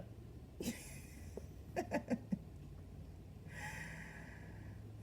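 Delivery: airy squealing laugh fit; high breathy laugh. Real, not acted. Cracking up at her kaboom joke, high breathy laughter. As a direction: let a high laugh escape.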